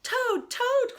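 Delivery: high loud cry of Toad's name. Acted. Frog crying out at Toad's door, high loud call. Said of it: "cried Frog".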